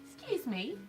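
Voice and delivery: high-pitched hammed-up British accent; mock British accent. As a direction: put on a silly, high British voice. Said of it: "if you don't have the accent".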